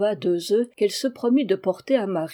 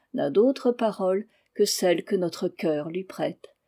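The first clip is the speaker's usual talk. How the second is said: usual speaking voice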